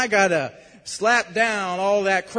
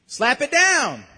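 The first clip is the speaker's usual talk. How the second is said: loud, higher preacher's exhortation; forceful command; 4 semitones higher, 6 dB louder, slower than usual. Real, not acted. Preacher telling congregation to reject the lie, loud and forceful. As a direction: punch it out as a rallying order.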